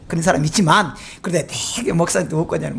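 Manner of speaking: usual speaking voice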